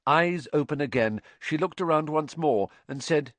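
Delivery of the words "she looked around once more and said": plain reading voice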